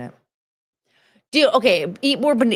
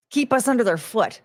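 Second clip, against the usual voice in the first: ordinary delivery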